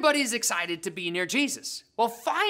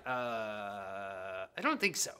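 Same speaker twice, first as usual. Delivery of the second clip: much lower, slow and soft; calm unbothered; 11 dB quieter, much slower than usual. Acted. Jesus calmly answers the panicked disciples, unbothered.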